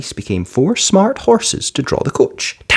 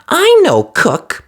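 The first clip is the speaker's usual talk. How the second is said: very high, loud pleased fairy; fiery fairy; 4 dB louder, much slower than usual. A narrator's reading. Fiery fairy choosing the dog Buttons, decisive. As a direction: snap it out decisively.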